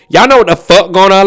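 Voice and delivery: punchy, put-on Atlanta talk; playful Atlanta accent. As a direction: slip into an Atlanta accent for fun. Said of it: "accent will get stuck in my head".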